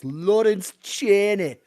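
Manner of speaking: high, loud, drawn-out Scottish cry; Lawrence Chaney in a Scottish accent. Shown as a demonstration. Caster shows off his only accent, loud Scottish impression. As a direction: belt out the name in a broad Scottish accent. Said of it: "say in a decent accent".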